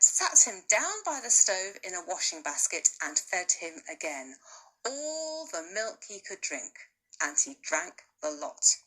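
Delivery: plain reading voice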